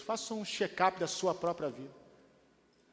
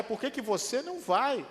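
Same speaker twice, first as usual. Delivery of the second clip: challenging question; 6 semitones higher, 4 dB louder than usual. Acted. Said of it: "repeated challenge".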